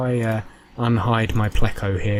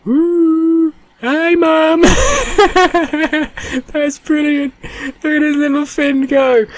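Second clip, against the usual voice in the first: excited squeal; 6 dB louder, slower than usual. Real, not acted. Cheering at the moving fin.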